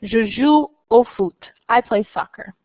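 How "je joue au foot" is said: Je joue au foot in clear French; careful French sentence; French example. Shown as a demonstration. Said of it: "Or I can say".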